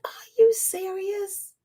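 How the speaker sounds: slow, stunned disbelief, held back; incredulous. Retold or imitated. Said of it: "And it's like".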